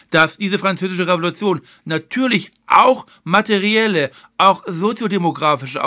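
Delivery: ordinary delivery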